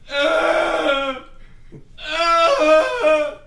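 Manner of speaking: furious shouting villain; villain rant. Acted. Villain ranting at window.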